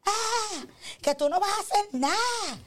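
high, mocking nag of a riled-up wife; typical angry wife. Wife needling her silent husband, mock indignant. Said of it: "típica mujer".

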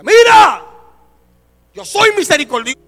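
God's booming rebuke, shouted high and slow; scolding shout. Retold or imitated. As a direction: thunder it down like a scolding God. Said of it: "Dios me da un regaño". (regaño de Dios a gritos)

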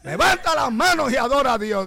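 high, full-force preacher's command; re-enacted rousing pulpit shout; shouted call to worship. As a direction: belt it out to the whole church.

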